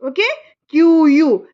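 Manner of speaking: ordinary talk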